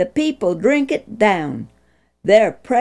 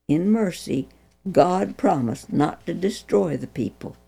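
plain reading voice

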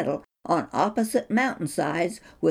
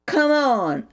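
louder, higher taunt; enraged taunt; 7 semitones higher, 5 dB louder than usual. A narrator's reading. Goliath taunting David, angry. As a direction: dare the upstart forward. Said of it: "insulted and enraged".

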